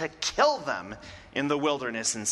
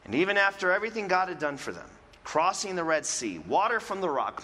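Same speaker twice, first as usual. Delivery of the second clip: ordinary delivery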